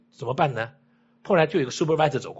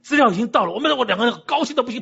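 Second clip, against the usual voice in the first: high, louder excited retelling; 高兴得不行; 8 semitones higher, 4 dB louder than usual. Retold or imitated. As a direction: relive the delighted news, pitch leaping up. (兴奋地提高音调复述好消息)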